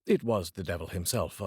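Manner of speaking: ordinary delivery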